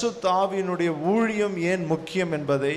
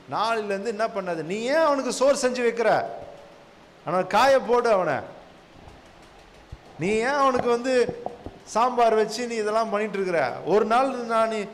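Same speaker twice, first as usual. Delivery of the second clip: higher-pitched mock scolding counsel; Sokkamma granny giving advice; 4 semitones higher than usual. Granny advising a caller on household fights, mock scolding. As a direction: imitate a meddling granny egging someone on, pitched up. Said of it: "Chokkamma என்ன சொல்லும்?".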